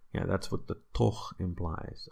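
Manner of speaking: ordinary delivery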